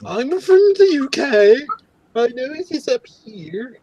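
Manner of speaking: very high, slow, snooty British; mock upper-crust British falsetto; posh British accent. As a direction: put on a snooty UK accent, drawn out and high-pitched. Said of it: "I sound like one of the posh U K people, like the snooty people".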